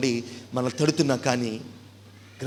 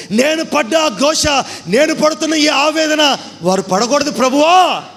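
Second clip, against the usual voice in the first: slow anguished wail, much higher and louder; rich man crying out; 9 dB louder, slower than usual. Acted. Rich man in hell begs God, slow wailing anguish. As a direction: drag out the anguish. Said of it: "అని దేవుడికి మొరపెడుతున్నాడు".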